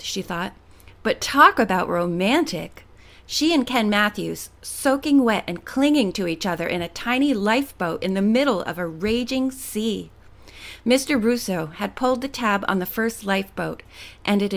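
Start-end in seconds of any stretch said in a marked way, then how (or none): none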